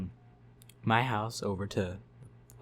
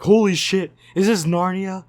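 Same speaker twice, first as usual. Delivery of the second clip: much higher, much louder amazed cry; stoned amazement; 9 semitones higher, 16 dB louder than usual. Retold or imitated. Stoned friends gawking at the snow, amazed loud cry. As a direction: gasp it out as if stepping into Narnia.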